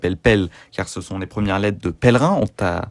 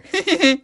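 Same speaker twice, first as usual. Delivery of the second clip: high laugh. Real, not acted. Laughing at his own accent.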